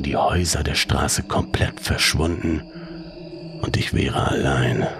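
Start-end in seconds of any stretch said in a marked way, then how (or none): none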